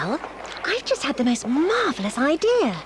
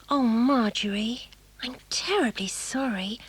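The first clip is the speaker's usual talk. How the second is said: hushed and sorry; sympathetic whisper; 2 semitones lower, 5 dB quieter than usual. Acted. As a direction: breathe it out gently, full of pity. Sympathetic apology to unhappy friend.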